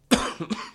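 rough cough; short hacking cough; smoker's cough. Real, not acted. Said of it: "smoker's cough".

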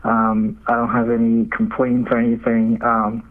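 usual speaking voice

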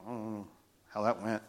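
low, very soft aside; hushed, deflated murmur; quiet uncertainty. Retold or imitated. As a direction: say it under your breath, unsure. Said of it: "He gets quiet".

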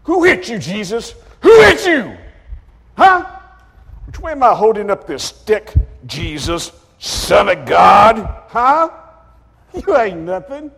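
high, loud jeering shout; brutal mocking soldier. Soldier beats blindfolded Jesus, shouting mockery. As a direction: scream taunts while striking.

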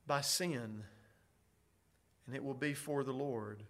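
ordinary talk, nothing marked

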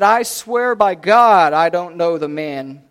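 insistent denial under oath; emphatic oath. Acted. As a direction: swear it forcefully, stretching the words. Peter swearing an oath in denial.

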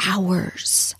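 breathy whisper, no voice at all. Shown as a demonstration. Host whispering to listeners how long she could talk. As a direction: drop to a secret whisper on "hours". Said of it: "drops to a whisper for emphasis".

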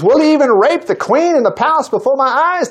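the king's outraged accusation; understated royal roar. Acted. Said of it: "The king roared".

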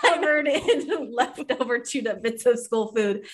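laughing in disgust; giggling through each word. Real, not acted. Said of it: "I'm so grossed out".

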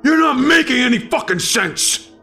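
high-pitched angry outburst; high, strained shout of frustration without much added volume; frustrated yell. Acted. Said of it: "I yelled".

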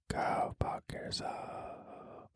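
drawn out, very slow whisper; slow teasing whispered curse; slow mock whisper. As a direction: stretch the whisper out, menacing in jest. Repeated mock insult, slower.

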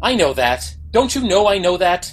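frustrated. Acted. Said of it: "Your vocalization of frustration".

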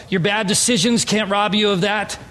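ordinary talk

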